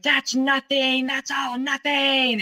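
high, drawn-out mock scream; retold scream. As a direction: imitate him screaming, stretching the words slowly. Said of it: "And he screamed".

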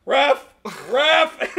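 loud, high shout for the referee; retold yell, half laughing; imitated calling out. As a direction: call across the field for help. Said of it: "And I was went".

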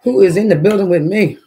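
loud hyped call to the crowd. Real, not acted. Streamer calls out again to viewers, loud. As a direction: shout it out to the chat.

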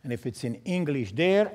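ordinary delivery

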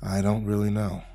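quiet, low, faint reply. A narrator's reading. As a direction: let it fade out, barely voiced. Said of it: "Faintly, Jack said".

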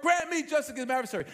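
rushed plea. Acted. Widow pestering the judge.

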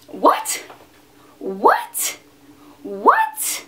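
can't believe what she heard; incredulous what. Real, not acted. Vlogger baffled by men's compliments, high incredulous what.

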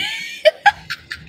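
high, squealing burst of laughter; excited laugh. Real, not acted. Overjoyed at the Chanel invite, she squeals with laughter. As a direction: let a real giddy laugh out. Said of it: "I just got real excited".

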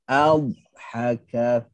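adhaka with the dh held long on the tongue edge; held dad. Shown as a demonstration. Teacher demonstrates istitalah in adhaka. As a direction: linger on the dh of adhaka without stopping. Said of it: "kita lamakan pada makhraj dia".

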